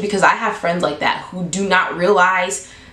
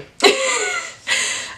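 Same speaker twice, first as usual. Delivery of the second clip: laugh. Real, not acted. Laughs at own hesitant reaction.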